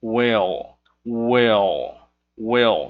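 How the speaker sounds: will with a clear L at the end, not an U sound; clear final L. Shown as a demonstration. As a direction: let the L ring at the end of will. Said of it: "não é will com som de U, mas sim o som de L".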